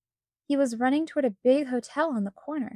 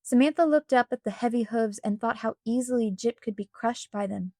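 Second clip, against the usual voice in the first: ordinary delivery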